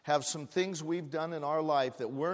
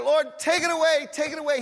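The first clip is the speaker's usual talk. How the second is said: high-pitched, urgent prayer; acted desperate pleading; begging plea; 8 semitones higher, 3 dB louder than usual. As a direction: beg God insistently, voice lifted. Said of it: "He pleaded, he pleaded, he begged".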